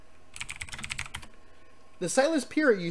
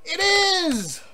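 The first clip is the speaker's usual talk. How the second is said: excited; 10 semitones higher than usual. Real, not acted. Ship still at dock.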